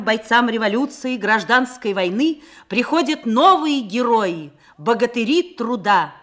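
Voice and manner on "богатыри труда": normal talking voice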